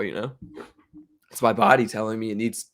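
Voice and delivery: ordinary delivery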